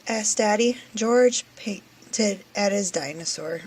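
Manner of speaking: plain reading voice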